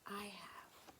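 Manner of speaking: ordinary delivery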